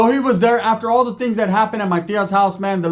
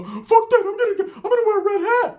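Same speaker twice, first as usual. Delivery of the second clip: high Ricky voice; 13 semitones higher than usual. Retold or imitated.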